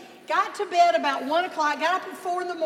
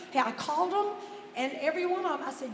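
normal talking voice

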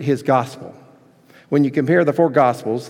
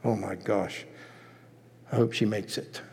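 hushed, fast gossipy half-whisper; gossiping aside; 7 semitones lower, 13 dB quieter than usual. Retold or imitated. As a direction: lean in and gossip behind her back. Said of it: "would you walk over and go".

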